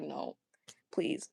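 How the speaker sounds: retold dread, sinking into a whisper; horrified whisper. Cringing at own old videos, horrified whisper. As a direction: drop to a low, hushed mock plea. Retelling horror at hearing own voice.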